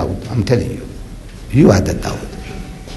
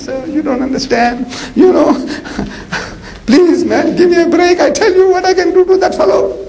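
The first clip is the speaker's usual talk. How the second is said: high, overpowering enthusiasm pleading with the commander; eager shepherd boy; 14 semitones higher than usual. Retold or imitated. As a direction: beg for the fight, bursting with eagerness. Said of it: "His enthusiasm is so overpowering".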